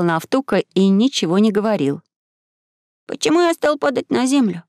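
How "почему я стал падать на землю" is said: put-on high-pitched boyish question; high, clear questioning; boy asking aloud. A narrator's reading. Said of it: "спросил вслух Максим, подбадривая".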